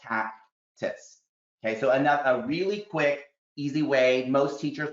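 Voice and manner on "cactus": cactus split into two syllables, cac-tus, quietly; segmented cactus. Shown as a demonstration. Teacher showing kids segmenting, quiet split cactus. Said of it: "break it apart to".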